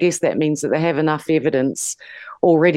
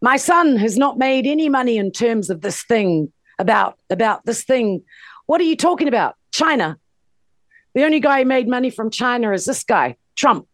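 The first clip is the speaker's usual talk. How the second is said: lightly mimicked politician, pitched up; Joe Biden; 7 semitones higher than usual. Retelling a presidential debate with Trump, mock Biden denial. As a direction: quote the debate denial in a higher voice. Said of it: "Biden said".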